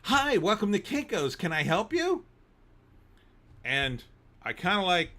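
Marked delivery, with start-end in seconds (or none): 0.0-2.2 bright high customer-service greeting, a touch raised; mock perky store clerk; chirpy clerk. Clerk greeting customer at the counter, mock perky. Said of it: "looked me dead in the eye and said".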